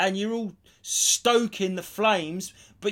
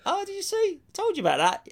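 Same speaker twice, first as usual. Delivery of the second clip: smug told you so. Retold or imitated.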